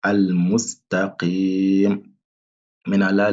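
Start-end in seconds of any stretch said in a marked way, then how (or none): 0.0-2.0 al-mustaqim très lent, voyelle tenue; prolonger la voyelle. Shown as a demonstration. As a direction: tenir le i de mustaqim longtemps. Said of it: "Faut prolonger ici au minimum deux temps". (Al-mustaqim étiré, voyelle longue)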